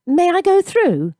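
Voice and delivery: high, polite little request; polite boy asking to get past. A narrator's reading. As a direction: ask gently to pass. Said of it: "Gabriel said politely".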